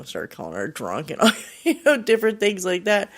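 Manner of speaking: a real laugh breaking into the words. Real, not acted. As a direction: laugh through it. Laughs mid-story.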